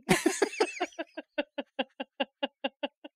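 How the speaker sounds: real, rolling laughter after the punchline; long helpless laugh. Real, not acted. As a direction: laugh it out at the joke. Laughing at the Scooby-Doo villain joke.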